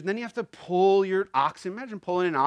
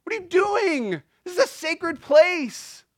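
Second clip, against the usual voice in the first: much higher, loud, slow indignant shout; preacher shouting as Jesus at the traders; 12 semitones higher, 8 dB louder, slower than usual. Retold or imitated. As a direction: roar it like Jesus in the temple. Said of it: "He's going".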